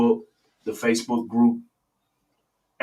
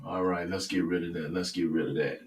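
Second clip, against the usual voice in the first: softer, lower muttering to self; muttered aside; 3 semitones lower, 8 dB quieter than usual. Real, not acted. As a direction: mumble it off-mic. Muttered aside while fixing music.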